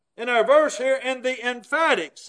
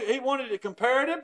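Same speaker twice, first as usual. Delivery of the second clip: usual speaking voice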